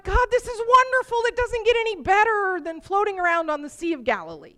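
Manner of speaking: praying aloud on the boat, high and moved; tearful praise. Retold or imitated. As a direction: pour it out with happy tears. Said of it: "tears falling down my cheeks".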